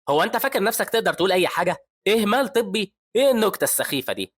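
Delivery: high, loud incredulous rage; angry outburst. A narrator's reading. Professor raging at the resident's malpractice claim. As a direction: scoff furiously at the accusation. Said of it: "بيتعصب هان وبيقول".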